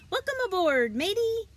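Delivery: high, loud pirate greeting; loud, high pirate hail from the deck; pirate yell. A narrator's reading. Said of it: "Nick yelled".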